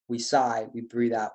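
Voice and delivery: usual speaking voice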